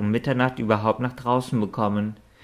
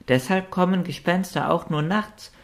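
sudden realization; 8 semitones higher than usual. A narrator's reading. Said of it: "bellte Noti, der plötzlich etwas klar geworden war".

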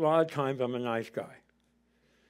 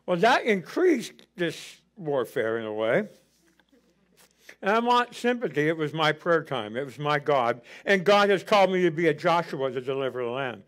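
ordinary talk

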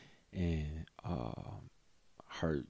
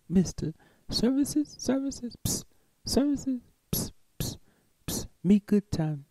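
high, whispered sales pitch; mock imitation of a street hooker; mocking solicitor; 11 semitones higher than usual. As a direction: hiss and coax like a street hustler. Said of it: "and going".

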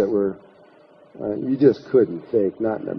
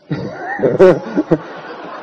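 real laugh; 6 semitones higher than usual. Real, not acted. Laughs retelling the boyfriend exposed.

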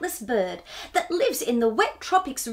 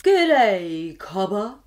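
deep slow owl; 4 semitones lower, 4 dB louder, much slower than usual. Acted. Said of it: "in a voice that was deep and slow".

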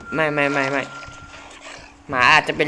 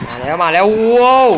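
loud, higher excited cry; excited shout; 5 semitones higher, 9 dB louder than usual. Real, not acted. Gamer shouting to viewers as the monster arrives, excited. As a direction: yell as it comes rushing.

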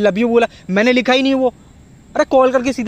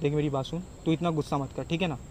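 soft placating; 6 semitones lower, 13 dB quieter than usual. Acted. Acted quarrel, boyfriend placating.

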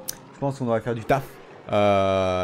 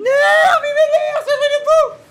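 much higher, louder, slow exasperated shout through laughter; laughing exasperated shout; 8 dB louder, much slower than usual. Real, not acted. Gamer fed up with the opponent's horde, laughing shout. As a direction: yell out your annoyance, half laughing.

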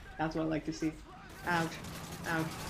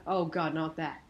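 normal talking voice

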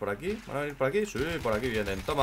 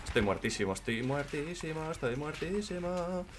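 rising mock lament; 9 dB quieter than usual. Real, not acted. Rising chant about being dead.

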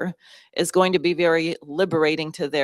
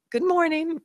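a much higher, sing-song Southern "Good morning", imitating her; the sunny houseguest greeting a grumpy host, Southern style; cheerful Southern greeting; 12 semitones higher than usual. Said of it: "she says".

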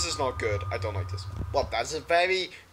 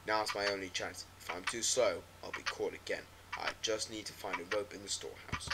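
low, quiet, hopeless diary voice; depressed auntie; 4 semitones lower, 6 dB quieter than usual. Acted. Streamer voicing the auntie's diary, low and defeated. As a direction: read it like a broken woman confessing.

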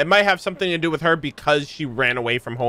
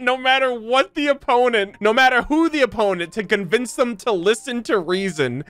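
reads the flavor text aloud in a raised, amused announcer voice with laughs breaking through; mock-grand item read; 5 semitones higher than usual. Streamer reading weapon lore to chat, amused announcer voice. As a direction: play up the lore text like a trailer narrator.

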